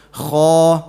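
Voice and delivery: Kha scraped from the throat, said loud. Shown as a demonstration. Teacher reciting a throat letter. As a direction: rasp the kh in the throat. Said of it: "Was kha from the throat letters".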